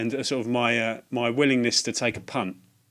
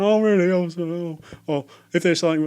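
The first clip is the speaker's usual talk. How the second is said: much higher, loud flustered gasp; nervous shopper squeaking back; flustered surprise; 8 semitones higher, 7 dB louder than usual. Retold or imitated. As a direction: caught off guard, voice jumping up with a laugh. Flustered reply to shop lady.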